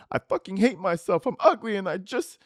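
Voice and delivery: put-on whiny girlfriend. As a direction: whine it like a wounded girlfriend. Reading her texts aloud.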